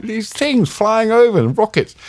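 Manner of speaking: high-pitched, alarmed retelling; mock panic. Foreign reporter pointing out rockets to an unbothered local, panicky. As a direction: act out a mild panic, pitch shooting up. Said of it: "like, panicking".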